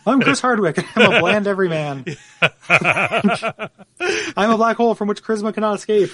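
reading while cracking up; can barely get the words out for laughing; laughing through it. Real, not acted. Mocking letter read aloud, laughing.

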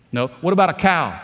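ordinary delivery

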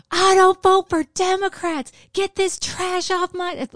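high-pitched mock screaming rant; retold screaming. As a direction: imitate an irate woman yelling, drawn out. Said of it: "starts screaming at me about".

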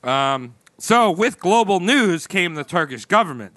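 ordinary talk

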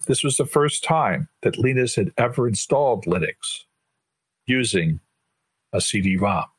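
ordinary talk

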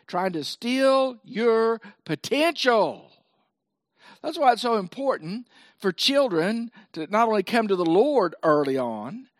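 ordinary delivery

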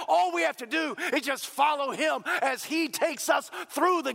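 building to a high, driving gospel shout; full-force preaching. Real, not acted. Preacher proclaiming cross and resurrection, fervent climax.

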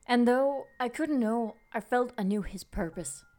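story narration. A narrator's reading. Reads the story over music.

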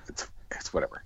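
dismissive near-whisper; shrugged-off whisper; whispered aside. Real, not acted. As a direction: let it drop under your breath. Shrugging off the accent topic.

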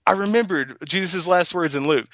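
high-pitched burst of remembering; sudden excited recall. Real, not acted. Remembering Jesus' last words in Luke, excitedly blurting it out.

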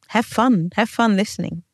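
ordinary delivery

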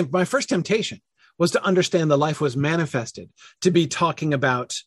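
ordinary delivery

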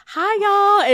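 high, sweet imitation of a cheery greeting; lightly mimicked sweet hello; sweet guest greeting. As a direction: chirp it like a sweet Southern hello. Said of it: "she's like".

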